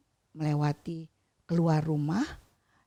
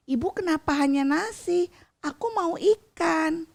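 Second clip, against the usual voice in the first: put-on a lightly higher, whiny child asking for fish; 6 semitones higher than usual. A narrator's reading. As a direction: nag your mother for fish like a small child. Child asks mother for fish. (anak kecil merengek ringan)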